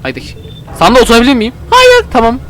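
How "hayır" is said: high cartoon voice saying no; stubborn little helper; squeaky flat refusal. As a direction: chirp a curt no way up high. Sando refuses in put-on voice.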